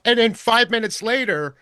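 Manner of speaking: normal talking voice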